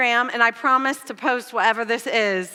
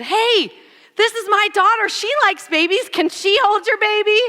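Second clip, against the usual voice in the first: eager ask; 8 semitones higher than usual. Retold or imitated. Asking stranger to hold baby.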